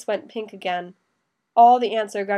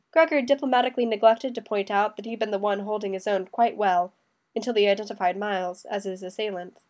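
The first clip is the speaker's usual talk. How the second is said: ordinary delivery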